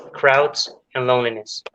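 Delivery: ordinary talk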